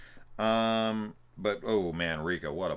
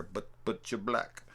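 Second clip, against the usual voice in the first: higher-pitched stammered Leo imitation; mock hesitant, higher voice; Leo impression; 4 semitones higher than usual. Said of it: "the way that Leo says that later".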